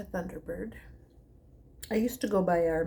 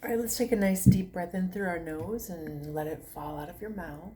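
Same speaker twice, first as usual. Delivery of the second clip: guided meditation. Acted. Yoga teacher to her viewers, guided meditation voice.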